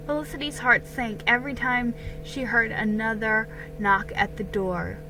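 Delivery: ordinary delivery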